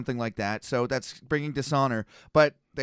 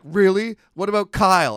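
louder, higher, eager question; excited quote; 5 semitones higher, 9 dB louder than usual. Retold or imitated. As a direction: play the friend, amazed and keen. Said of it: "He's like".

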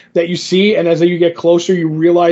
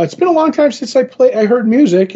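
lightly voiced ferryman, pitched up high; retold ferryman; 7 semitones higher than usual. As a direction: start high and ease down, as a character in a retelling.

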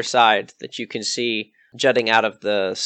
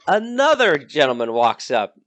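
ordinary talk, nothing marked

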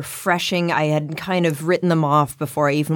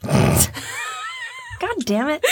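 laughing frustration; 3 dB quieter, much slower than usual. Real, not acted. Catches own year mistake.

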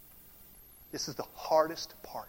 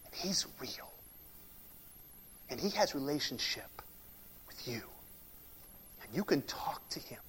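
soft, intimate hush about God; 4 semitones lower, 10 dB quieter than usual. Real, not acted. Preacher telling the congregation God is near, hushed. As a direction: drop to a near whisper.

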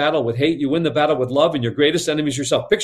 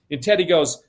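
usual speaking voice

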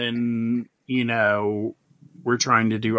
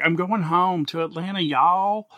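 higher, lightly imitated country twang; light southern accent; 7 semitones higher than usual. Imitating a country TikToker heading home, in a light southern twang. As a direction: play the Georgia guy, y'all. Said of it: "and he's like".

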